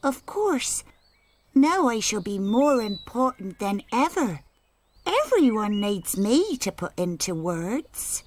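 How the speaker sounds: high, pleased-with-herself boast; self-important. Acted. Annie Apple boasting she is most important, smug. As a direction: puff yourself up proudly.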